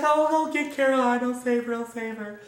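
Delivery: high, frantic mother fretting; hammed-up worried mom; mock panic. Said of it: "she's freaking out".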